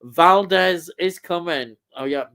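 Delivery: ordinary talk, nothing marked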